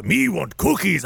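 mock Cookie Monster cookie demand. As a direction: growl it like a hungry puppet monster. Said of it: "voice that I did".

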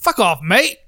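punchy fake Aussie accent; mock Australian accent. Shown as a demonstration. Co-host offering his accent for placing, mock Australian. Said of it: "All right, where's mine from?".

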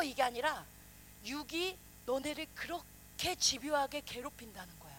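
normal talking voice

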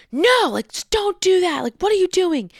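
high, urgent re-enacted plea; alarmed protest. Driver telling drunk man to leave the gun, alarmed protest. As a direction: stop him, voice jumping up high.

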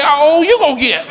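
high, loud snappy comeback; mock comeback. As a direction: fire it right back. Comic's comeback to old lady.

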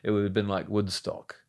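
put-on lightly done London punk English accent; quoting his old character in a light London English accent. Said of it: "That's such a good accent".

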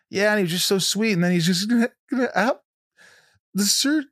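high, choked-up voice welling with tears; reliving the moment the tears came, voice rising high; retold teary gush. Retelling a sweet kid's story to a friend, near tears. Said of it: "And I was just a mess".

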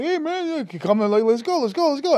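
high-pitched mock hustle; eager urging. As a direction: imitate people waving you along excitedly. Others retold urging them along.

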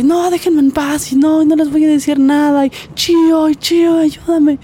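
high, loud pleading cry for help; retold desperate plea. Wife in the patrol car crying out for Chío, high desperate plea. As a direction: voice the wife begging to be left alone, pitched high. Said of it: "Que dice".

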